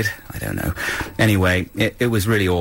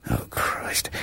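whisper; 9 dB quieter than usual. Real, not acted. Muttered aside during apple story.

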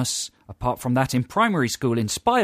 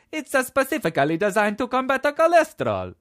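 much higher, louder Italian chef voice; silly Italian accent; 12 semitones higher, 5 dB louder than usual. Retold or imitated. Host mimicking the chef's advert line, Italian accent. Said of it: "Literally like that".

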